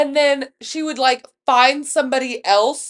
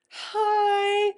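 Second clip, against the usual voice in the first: high, drawn-out little hello; mock innocent greeting; 9 semitones higher than usual. Friend greeting her from the couch, imitated high and drawn out. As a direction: imitate a sheepish, sing-out greeting.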